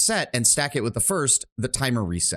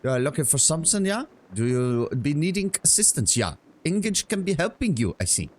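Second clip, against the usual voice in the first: higher-pitched, hammed-up German merchant; bad German accent; 6 semitones higher than usual. Shopkeeper offering a customer help, bad German accent. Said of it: "worst frickin' German accent".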